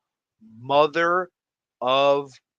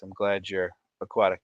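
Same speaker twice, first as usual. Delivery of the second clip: ordinary talk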